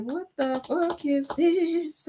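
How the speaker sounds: slow, drawn-out shocked question; appalled, slowed-down what; disgusted disbelief. Retold or imitated. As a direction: stare at the glass and drag it out in disgust.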